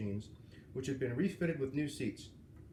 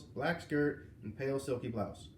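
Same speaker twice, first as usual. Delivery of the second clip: usual speaking voice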